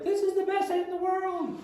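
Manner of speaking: put-on high, thrilled kid voice; excited kid. Kids suddenly thrilled.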